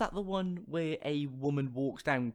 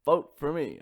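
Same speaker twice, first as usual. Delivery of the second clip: Obama impression; 5 semitones lower, slower than usual. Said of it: "Barack Obama impression".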